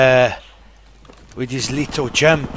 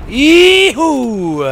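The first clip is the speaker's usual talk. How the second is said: high, loud joyful whoop; excited whoop; 10 semitones higher, 5 dB louder than usual. Real, not acted. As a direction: cheer it out with a whoop. Celebrating a kill.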